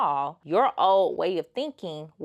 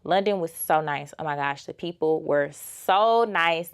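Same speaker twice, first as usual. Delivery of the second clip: normal talking voice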